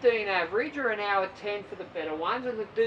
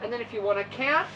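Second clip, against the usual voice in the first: ordinary talk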